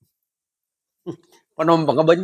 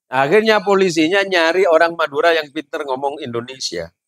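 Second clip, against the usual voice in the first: normal talking voice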